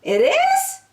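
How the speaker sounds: high, eager rising "It is?"; light up with sudden hope; bright surprise. Acted. Franklin hearing spring is near, delighted surprise.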